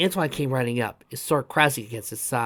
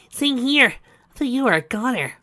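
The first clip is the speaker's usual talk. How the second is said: Tails voice; 12 semitones higher, 4 dB louder than usual. A narrator's reading.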